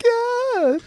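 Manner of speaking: squeaky-high mock metal falsetto; super high voice. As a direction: send it way up high, joking. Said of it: "super high voice".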